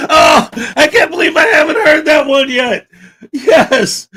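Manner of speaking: high, loud burst of laughing disbelief; laughing shout. Real, not acted. Streamer reacting to a chat pun, laughing loud. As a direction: shout it out while cracking up.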